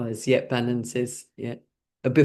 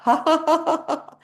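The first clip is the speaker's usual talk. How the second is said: forced laughter; 14 semitones higher than usual. Shown as a demonstration. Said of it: "the hot food laughter".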